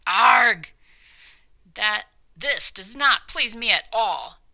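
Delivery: loud, furious roar of an angry king; stormy royal growl. Acted. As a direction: bellow it like a king in a rage. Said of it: "stormed, grumbled, and growled the king".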